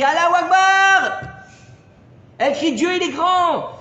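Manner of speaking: high ringing shout of the call. Retold or imitated. The horn in Daniel's dream cries out, shouted high. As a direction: cry it out like a call from a tower.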